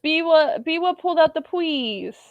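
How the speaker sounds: put-on high baby talk with w for l. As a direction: plead like a toddler. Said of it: "pulling out the baby voice".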